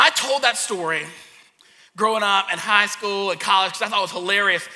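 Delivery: normal talking voice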